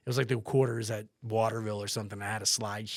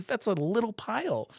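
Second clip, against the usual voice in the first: incredulous; 12 semitones higher, 4 dB louder than usual. Retold or imitated. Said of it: "I'm like".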